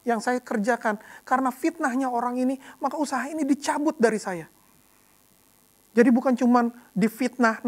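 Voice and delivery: ordinary delivery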